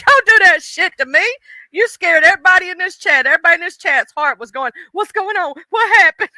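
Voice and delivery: loud, high, laughing don't do that; excited laughing scold. Real, not acted. Streamer scolding J B in chat, laughing and loud. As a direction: tease him while still shaken.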